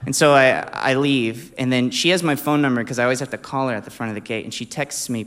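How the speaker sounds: ordinary talk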